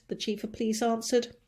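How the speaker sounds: ordinary delivery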